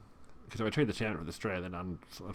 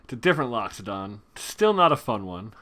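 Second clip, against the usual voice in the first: loud groan; 9 dB louder than usual. Real, not acted. Groaning at opponent's Loxodon.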